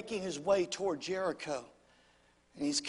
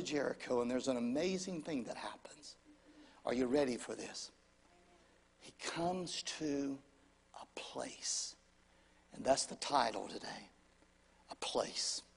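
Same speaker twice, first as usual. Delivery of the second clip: much lower and softer, a whisper; suspense whisper; 8 semitones lower, 10 dB quieter than usual. Real, not acted. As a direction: draw them in with a hushed reveal. Builds suspense toward the sermon title.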